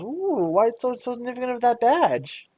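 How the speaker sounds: put-on high, gushing old-lady voice; pry with fake wonder; the shopkeeper fussing over the badge in a high coo; nosy shopkeeper. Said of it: "the woman behind you goes".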